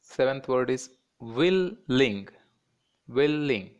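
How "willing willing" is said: "willing" split as wil-ling; two distinct beats on "willing"; two separate syllables. Shown as a demonstration. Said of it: "that is two syllables".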